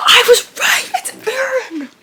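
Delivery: very high, loud squeal; excited vindication. Real, not acted. Reader finds their guess confirmed, thrilled squeal. Guess about character proves right.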